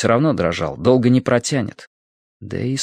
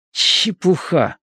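through clenched teeth; 8 semitones higher, much slower than usual. A narrator's reading. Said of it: "процедил он".

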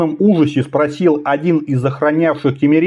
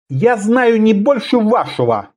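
grim, higher-pitched reply; gloomy; 6 semitones higher than usual. A narrator's reading. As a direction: answer with understated gloom. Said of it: "мрачно ответил королевский посланник".

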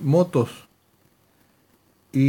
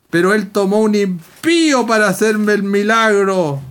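raised, ringing testimony climax; higher, emphatic preacher's proclamation; full-force preaching; 5 semitones higher, 5 dB louder than usual. Real, not acted. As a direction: drive each phrase home to the congregation.

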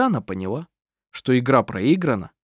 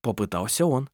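usual speaking voice

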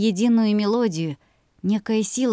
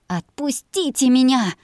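furious captive cry out; loud growling demand; growled; 6 semitones higher, 4 dB louder than usual. Acted. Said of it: "прорычала я".

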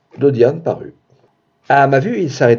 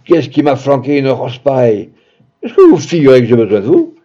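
loud, raised-pitch outburst; angry shout; 3 semitones higher, 7 dB louder than usual. A narrator's reading. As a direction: yell it at her in exasperation. (cri de colère, exaspéré)

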